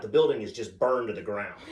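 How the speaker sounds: normal talking voice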